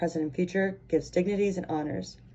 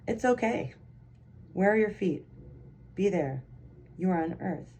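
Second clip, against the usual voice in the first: calm them down line by line; meditative reading; 5 dB quieter than usual. Acted. Reads a guided meditation excerpt.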